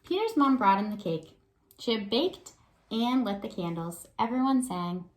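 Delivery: put-on lively read-aloud for young kids; story narration. A narrator's reading. Teacher reads picture book aloud.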